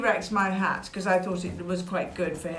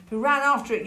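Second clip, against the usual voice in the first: usual speaking voice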